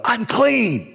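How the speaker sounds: drawn-out high cry of warning; yelled warning. Acted. Leper to an approaching healthy person, yelling a warning. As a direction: call out slowly to keep people back. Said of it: "said you had to yell out".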